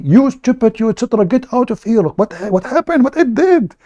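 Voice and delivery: high, mocking rant from angry chat users; mock angry chatters. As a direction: play the outraged chat crowd, pitch way up. Chat users yelling at him.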